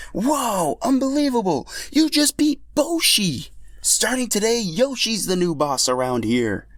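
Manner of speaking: can't believe what they just saw; amazed fan. Acted. Crowd member cheers the win.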